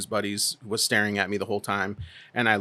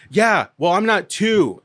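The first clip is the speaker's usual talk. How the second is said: higher, louder huffy imitation; mock angry man; 9 semitones higher, 6 dB louder, slower than usual. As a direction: parody his childish comeback. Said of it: "he's like".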